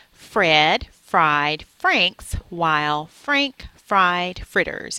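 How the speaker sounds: very slow tongue twister, each word loud and separate; slow, careful enunciation. Shown as a demonstration. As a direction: space out and hit every word. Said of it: "slowly and enunciate my words".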